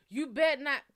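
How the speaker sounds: loud warning; loud threat. Retold or imitated. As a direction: bark the warning. Retold threat.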